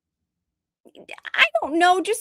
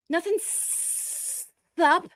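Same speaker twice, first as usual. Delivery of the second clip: mocking drawl; 6 semitones higher, 6 dB quieter, much slower than usual. Mimicking viewer's sup.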